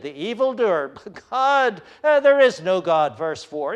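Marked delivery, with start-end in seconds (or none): 1.3-3.2 loud, high mocking claim; mock the idea of God; scoffing denial. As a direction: sneer it like the arrogant wicked. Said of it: "arrogance of the wicked".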